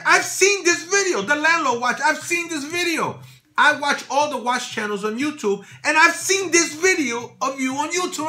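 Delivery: high, loud, starstruck gush; excited fan. Retold or imitated. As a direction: gush like meeting a favorite YouTuber. Owner raving about the videos.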